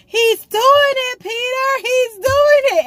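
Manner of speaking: put-on very high, slow, amazed child voice; little boy from Peter Pan. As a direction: gasp it out like an awestruck kid. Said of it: "he was like".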